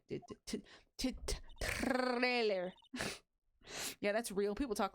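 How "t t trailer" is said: stuttered T's bursting into a long rolled "trailer"; stammer, then roll and stretch the R in "trailer"; hammed-up stutter.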